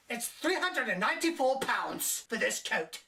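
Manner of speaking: lightly voiced haughty clerk; snooty coat lady. Coat lady quoting the price, mock snooty. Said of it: "The coat lady is a complete bitch".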